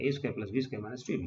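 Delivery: fast rattled-off formula; rapid rote recitation; quick recitation. Shown as a demonstration. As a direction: rattle off the formula like a memorised rule. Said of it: "teacher rattles off known formula".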